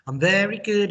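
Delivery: high bright answer, "good" falling; teacher modeling a happy reply; cheerful model answer. Shown as a demonstration. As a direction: sing out "good" to the class. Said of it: "I do the English".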